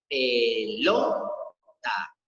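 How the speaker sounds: "pelota" drawn out slowly, LO held long and rising; stress on LO. Shown as a demonstration. Teacher shows pupils where the tonic syllable is, stressed LO in "pelota". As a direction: stretch the middle syllable of "pelota". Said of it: "la sílaba tónica se encuentra en lo".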